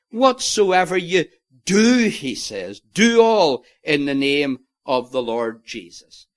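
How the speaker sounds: pause, then hit "do" hard; stress on do. Shown as a demonstration. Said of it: "read it with the emphasis on the word do".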